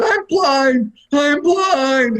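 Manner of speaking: high, loud mock panic. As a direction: play a hammed-up freak-out. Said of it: "I would've freaked out".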